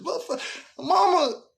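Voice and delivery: fed up, begging her to talk straight; exasperated whine. Retold or imitated. Son to mama, fed up with her vague answer, whining high.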